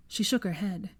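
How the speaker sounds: plain reading voice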